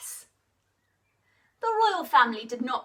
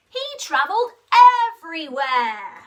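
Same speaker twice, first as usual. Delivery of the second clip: rising excitement; 2 semitones higher, 10 dB louder than usual. A narrator's reading. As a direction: climb up with excitement. Travelling here, there, everywhere.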